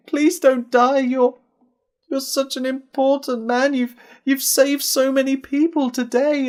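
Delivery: high, loud, distraught begging; tearful pleading. A narrator's reading. Ben begging his dying friend not to die, tearful plea. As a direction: plead through tears, voice pitched right up. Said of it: "Ben had tears starting to pour".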